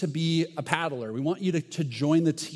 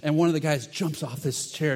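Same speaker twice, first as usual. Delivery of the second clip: ordinary talk, nothing marked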